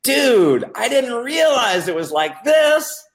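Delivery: mock awestruck excitement, voice way up; suddenly won over. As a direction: imitate a prospect blown away by the idea. Imitating suddenly won-over prospect.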